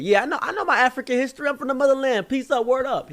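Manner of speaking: very high, loud mock voice. Man from the group claiming African roots, high mock voice. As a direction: rattle off a mocking high-pitched street boast. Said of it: "He said".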